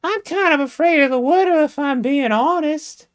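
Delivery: put-on high, prim falsetto, like a coy young lady. Jamesina speaking to James, in a high falsetto lady voice. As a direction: play a coy Southern belle up high.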